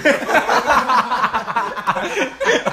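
long, loud, high laughing fit; helpless laughter. Real, not acted. Group cracks up at dab joke.

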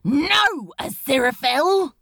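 loud desperate cry of the name; pleading shout. Acted. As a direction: call out to stop him. Crowley cries out to angel.

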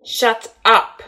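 loud, snarled command; angry snarl. A narrator's reading. Woman answering his taunting, angry snarl. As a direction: snarl it loudly to silence him. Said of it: "she snarled".

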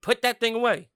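mock wife yelling at her man; annoyed wife. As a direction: snap at him like a fed-up girlfriend. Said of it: "she be like".

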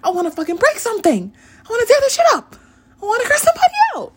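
building to a raging yell, fast at the end; whisper yelling. Retold or imitated. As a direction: start annoyed, then blow up into a yell. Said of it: "whisper yelling".

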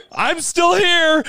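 high, loud, drawn-out taunt; mock triumphant shout. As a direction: crow it slowly to those who tried to ban you. Gleeful retort acted after failed ban.

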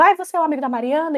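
imitating an overeager acquaintance greeting the date; high gushing friend. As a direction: rattle it off, overfriendly. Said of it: "Ela já chegou".

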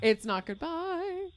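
high, slow, pleading; tearful plea. Real, not acted. To Teresa at the farewell, high and slow pleading. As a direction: voice lifted and drawn out, near tears.

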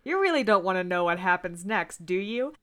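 ordinary talk

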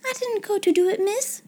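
put-on high, pleading servant girl; high-pitched flustered excuse; anxious protest. A narrator's reading. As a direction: protest innocence in a little high voice. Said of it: "protested Becky".

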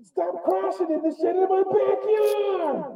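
very high, strained comic holler; exasperated high-pitched wail through the door; mock screaming. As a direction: shriek complaints at a pesky trick-or-treater. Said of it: "that shit be screaming from the inside".

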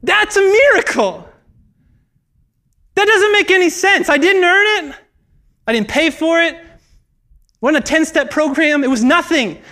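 real awe, high and loud; amazed exclaim. Real, not acted. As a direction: let the wonder burst out. Preacher marvels at grace.

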